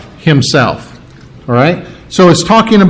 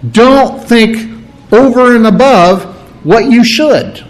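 raised, pressing don't think over and above; earnest preacher's warning, higher voice; emphatic warning; 6 semitones higher than usual. Retold or imitated. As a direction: urge them firmly, pitched up. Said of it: "Paul here is saying".

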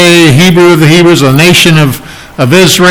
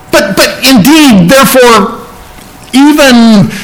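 much higher, tumbling out in bursts; mock excited stutter; 6 semitones higher than usual. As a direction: push the words out as if overflowing. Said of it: "like he wants to get this out so much".

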